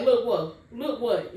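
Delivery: loud, sharp demanding mom; angry mom. Retold or imitated. Mom confronting daughter holding a test, angry loud demand. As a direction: bark it back like an outraged mother.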